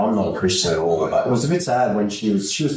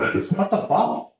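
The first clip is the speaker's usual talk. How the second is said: high, slow disbelief; drawn-out mock astonishment; retold shock; 11 semitones higher, much slower than usual. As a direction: act out being stunned, voice pitched way up. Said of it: "I was, I was shocked".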